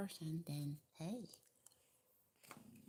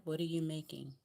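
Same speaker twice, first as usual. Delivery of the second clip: normal talking voice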